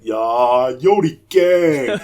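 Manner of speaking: mock catchphrase. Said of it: "went with me and went".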